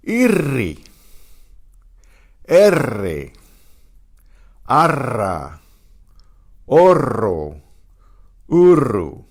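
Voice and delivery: irri, erre, arra, Orro, uru with rolled double R; trilled R. Shown as a demonstration. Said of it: "The double R is a trilled R".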